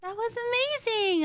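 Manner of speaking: high, slow gushing praise. Real, not acted. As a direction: squeal how amazing it was. Praising partner's song.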